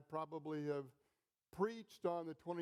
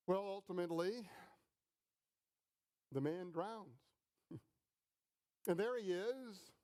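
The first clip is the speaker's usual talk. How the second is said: ordinary talk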